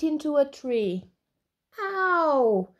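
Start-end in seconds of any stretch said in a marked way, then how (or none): 1.8-2.7 high, drawn-out yelp of pain; mild storybook shriek. Acted. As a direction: cry out high as if hurt. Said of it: "shrieked Alby".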